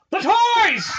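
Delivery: high, loud sudden outburst; a dying old man bursting out loud and high; sudden cry. Retold or imitated. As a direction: jolt awake and shout it. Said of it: "snaps to".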